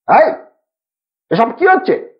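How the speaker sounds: put-on a sharp scolding bark at the boys; a stern old man snapping at noisy kids. A narrator's reading. Uncle scolds the boys for chattering, in a sharp scold. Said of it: "ধমক দিয়ে বললেন".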